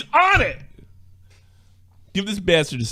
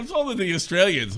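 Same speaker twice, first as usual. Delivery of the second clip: ordinary delivery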